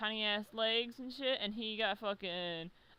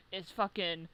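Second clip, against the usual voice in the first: ordinary talk, nothing marked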